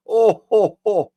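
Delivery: high, loud "oh-ho-ho"; high-pitched hooting laugh; amused hooting. Real, not acted. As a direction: hoot with amusement at a groaner. Reacts to the joke.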